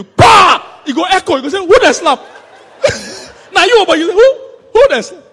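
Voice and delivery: mock dazed shout. Preacher acting out a slap to the congregation, loud comic yell. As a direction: ham up the shock of a slap.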